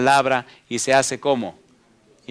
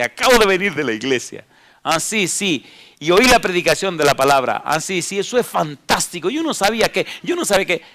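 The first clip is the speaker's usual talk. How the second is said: higher, thrilled mock convert; excited new believer; 5 semitones higher, 4 dB louder than usual. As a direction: gush about the sermon you just heard. New believer excited after church. (creyente emocionado, voz más aguda)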